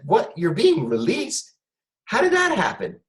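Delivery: high, exaggerated disbelief that climbs; mock surprise. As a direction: ham up the amazement, pitch shooting up. Feigning surprise at cellmate's release.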